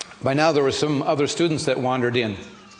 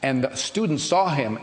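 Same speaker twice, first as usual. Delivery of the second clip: ordinary talk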